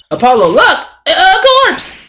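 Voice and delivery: loud, high stammering alarm; panicked girl. Acted. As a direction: cry out in high-pitched fright. Girl character frightened by a corpse.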